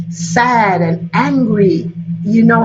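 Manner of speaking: ordinary delivery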